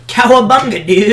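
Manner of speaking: high, loud goofy holler; mock exuberant shout. Co-host re-enacting the class outburst, goofy shout. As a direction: shout it like a cartoon catchphrase.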